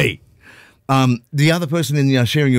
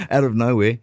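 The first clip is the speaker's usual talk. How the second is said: ordinary talk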